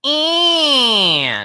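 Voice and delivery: high, loud, drawn-out "eh"; cartoon-rabbit "eh", held and sliding down; bright pharyngeal twang. Shown as a demonstration. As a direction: demonstrate a piercingly bright pharyngeal sound. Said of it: "this really bright sound".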